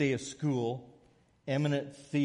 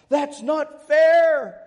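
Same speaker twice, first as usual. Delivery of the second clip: loud, high, slow outraged howl; howled protest; 13 dB louder, much slower than usual. Retold or imitated. Students howling at the professor in protest, loud high wail. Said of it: "They howled in protest".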